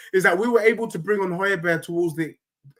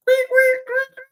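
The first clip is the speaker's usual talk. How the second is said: very high squeak squeak squeak; very high imitated squeaks; squeaky noise; 4 dB louder, slower than usual. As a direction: squeak like a nervous bum.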